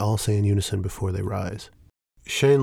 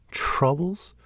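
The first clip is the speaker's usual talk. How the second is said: sharp high rising disbelief; incredulous; 8 semitones higher than usual. Acted. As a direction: throw the word back in disbelief. Shane disbelieving Fel's word.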